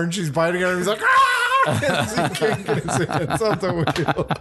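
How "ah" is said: high sharp scream of pain, loud. Retold or imitated. Barry screaming as the girl bites his neck, high yelp. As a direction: yelp like a neck is being bitten. Barry bitten, hands glued to wheel.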